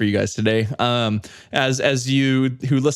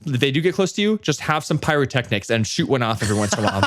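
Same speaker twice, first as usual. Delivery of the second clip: ordinary talk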